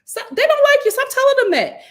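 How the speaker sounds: high, loud scolding; frustrated loud scold; exasperated scolding. Real, not acted. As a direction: yell the advice at her. Scolding the housewife.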